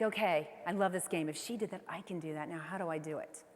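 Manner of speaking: hushed self-talk, working out the next step; quiet inner thought. Retold or imitated. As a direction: think it through under your breath. Inner thought after seeing mentors.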